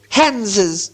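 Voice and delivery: high creature voice, hissed ending; Gollum. A narrator's reading. Gollum makes his first guess, high and hissing. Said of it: "said Gollum".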